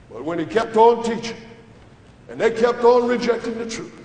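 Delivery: ordinary delivery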